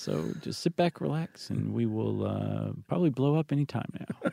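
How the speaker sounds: calm captain breaking bad news; airline pilot announcement. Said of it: "It's your best impression".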